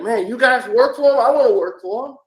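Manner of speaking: high, loud excited fan quote; eager fan. Retold or imitated. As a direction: gush like a fan who wants in.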